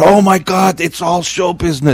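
high, exclaimed realization; acted amazement. Watching preacher sync with comedy album, acted amazement.

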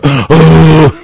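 loud, high, drawn-out "ohh"; imitated crowd "ohh". Whole club on acid reacting, imitated awe. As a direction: let out a big mock awed "ohh".

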